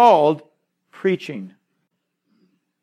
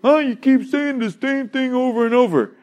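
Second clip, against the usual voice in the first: high-pitched mock exasperation, as if insulted; indignant reader; 7 semitones higher than usual. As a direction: act out an annoyed listener's complaint. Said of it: "you wonder when you read this".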